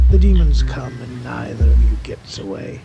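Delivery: plain reading voice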